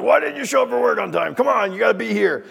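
high-pitched indignant scolding; angry boss. Acted. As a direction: play a boss telling off a late worker. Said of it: "your boss gets angry at you".

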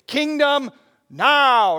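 a ringing proclamation of kingdom now; Doctor Dolvin's catchphrase. Retold or imitated. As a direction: belt it like a preacher quoting his teacher. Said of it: "you kept hearing him say".

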